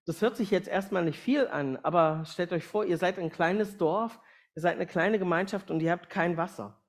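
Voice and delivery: ordinary talk, nothing marked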